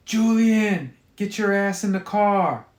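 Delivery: loud, high angry mother shout; yelling. Acted. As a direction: yell it across the store. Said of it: "Tammy yells".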